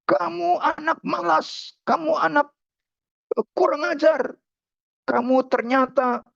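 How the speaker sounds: high-pitched angry scolding; acted angry dad, starting very high; furious father. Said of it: "Lalu ayahnya itu marah besar".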